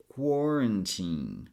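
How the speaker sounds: put-on QUARANTINE said slowly, last syllable as TEEN; ending in teen. Shown as a demonstration. Teacher putting the word together, slow "quarantine" ending like teenager. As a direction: stretch "quarantine", with a weak middle and a long EE ending. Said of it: "pronounce this last syllable as".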